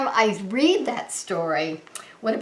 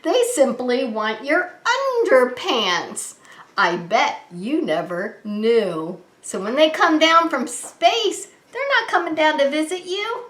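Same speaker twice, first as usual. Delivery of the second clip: lively sing-song picture-book read; reading to children; 6 semitones higher than usual. Acted. Reading a silly rhyming book to kids, sing-song. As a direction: bounce the rhyme for little listeners.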